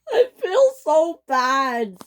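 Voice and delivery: high, slow sobbing little engine; crying. A narrator's reading. Belle crying to Proteus about the flood. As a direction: wail it out as a sad toy train. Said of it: "cried Belle some more".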